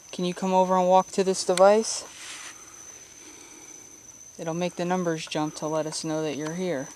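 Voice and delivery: loud coaxing call to a spirit; talking to a ghost. Real, not acted. Calling out to the spirit Martha.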